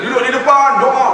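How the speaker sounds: loud laughing call to the crowd. Retold or imitated. Preacher calling pilgrims forward, loud. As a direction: call them to sit in front and pray.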